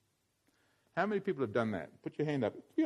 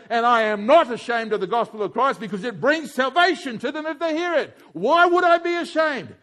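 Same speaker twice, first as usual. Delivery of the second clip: raised, fervent preacher's voice; preaching at full force; 11 semitones higher, 10 dB louder than usual. Acted. Preacher rallying the congregation, fervent and raised. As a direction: preach it out to the whole room.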